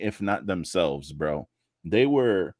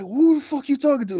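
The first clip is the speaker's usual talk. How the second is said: high, loud hammed-up snap; mock angry yell; 5 dB louder than usual. Husband snapping back at his wife, high mock rage. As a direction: flip out on your wife. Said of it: "He's like".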